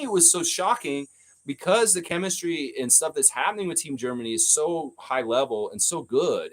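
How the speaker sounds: normal talking voice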